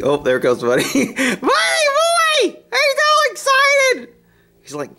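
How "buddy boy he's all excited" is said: excited pet talk. Acted. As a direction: call out to him in delighted baby voice.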